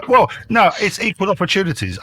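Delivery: amused, high-pitched denial; laughing protest. Real, not acted. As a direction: defend the joke while still laughing. Laughing defence of joke.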